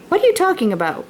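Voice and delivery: Pa's puzzled high question; quick and puzzled, as if caught off guard; surprised question. Acted. Pa asking Laura what she means, surprised. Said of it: "Pa asked".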